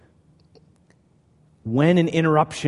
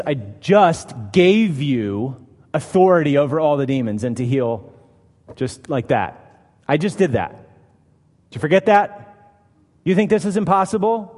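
castigating rebuke; 2 semitones higher, 4 dB louder than usual. Retold or imitated. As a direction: scold them like a frustrated teacher. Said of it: "probably could have castigated them".

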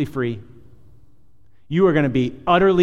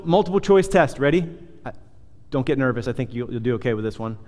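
ordinary talk, nothing marked

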